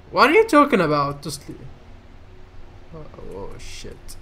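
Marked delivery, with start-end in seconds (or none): 0.1-1.2 incredulous. Real, not acted. Baffled by crazy lady. 2.9-4.2 breathy whispered swear; whispered curse. Real, not acted. Reacting under breath.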